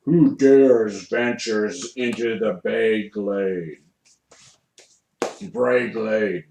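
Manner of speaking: slow, gruff forest guardian voice; a slow, gruff challenge from the woods. Acted. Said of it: "a gruff voice".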